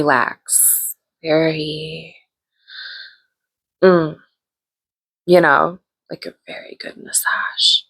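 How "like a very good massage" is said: sly conspiratorial whisper; knowing hushed whisper. Retold or imitated. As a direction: whisper it like a secret. Sly whisper about massage.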